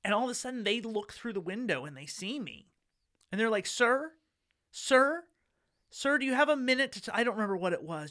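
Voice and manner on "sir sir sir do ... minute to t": high, loud repeated calls of sir; calling out. Retold or imitated. Solicitor calling through the kitchen window to the homeowner, loud and high. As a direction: call through the window to get his attention.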